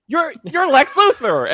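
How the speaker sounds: high, loud outraged exclamation; theatrical, high-pitched accusing yell; mock accusation. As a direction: pitch it way up in exaggerated disbelief. Retells accusing her of villainy.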